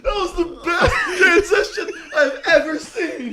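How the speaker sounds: laughing shout. Real, not acted. Laughing loudly.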